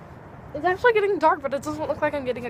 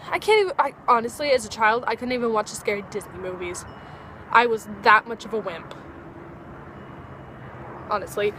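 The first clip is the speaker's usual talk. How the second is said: ordinary talk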